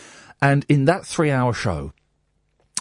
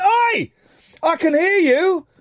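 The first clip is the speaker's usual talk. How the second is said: shouting; 14 semitones higher, 3 dB louder, slower than usual. Retold or imitated. Shouting at colleague outside toilet.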